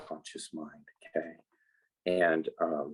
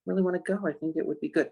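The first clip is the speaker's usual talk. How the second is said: eager you; 10 semitones higher, faster than usual. Acted. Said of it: "you're like".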